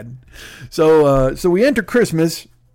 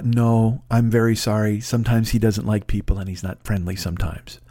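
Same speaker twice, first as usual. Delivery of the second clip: low, subdued apology; apologetic dog owner; 8 semitones lower than usual. Retold or imitated. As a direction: imitate a polite owner gently declining, pitched low.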